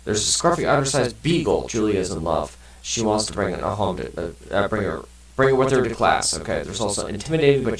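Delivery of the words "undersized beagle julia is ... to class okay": ordinary delivery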